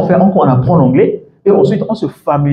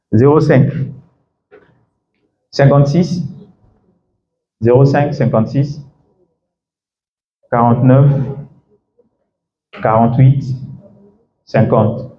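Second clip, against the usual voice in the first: slow dictation; slower than usual. Shown as a demonstration. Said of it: "Prenez mon numéro".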